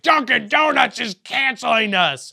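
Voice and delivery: mock alarm. Quoting the brand's email dramatically.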